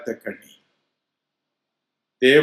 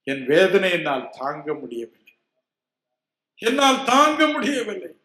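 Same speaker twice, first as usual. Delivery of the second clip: rising, louder cry of pain; anguished plea; 7 semitones higher, 4 dB louder than usual. Retold or imitated. Sick man crying to Jesus, high and louder. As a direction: plead that you cannot bear it.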